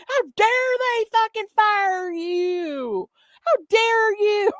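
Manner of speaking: high, slow, hammed-up indignant shriek; mock outrage. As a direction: overplay her shocked fury, stretching each how dare. Mocking her outrage.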